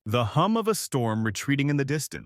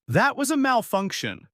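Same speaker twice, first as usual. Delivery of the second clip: angry growl; 10 semitones higher than usual. A narrator's reading. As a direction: snap "That was a malfunction" back high and hot. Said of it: "Ares growls".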